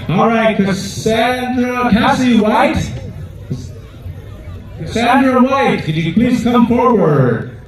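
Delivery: slow, drawn-out name calling; powwow emcee. Acted. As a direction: call each name out to the arena. Calling a dancer forward.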